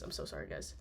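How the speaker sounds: breathy whisper; whispered apology. Real, not acted. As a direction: whisper it after the sneeze. After sneezing.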